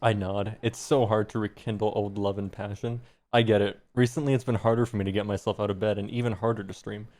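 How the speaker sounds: ordinary delivery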